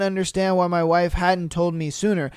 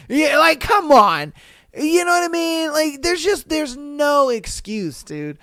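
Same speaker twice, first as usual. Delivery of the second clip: exasperated; 8 semitones higher than usual. Real, not acted. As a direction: protest it high, stretching the key words. Exasperated at wife not texting.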